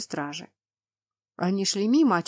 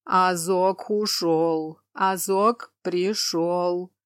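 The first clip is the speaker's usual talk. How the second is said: tearful giant; much slower than usual. Acted. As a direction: drawl it out, close to tears. Weeping cyclops complains.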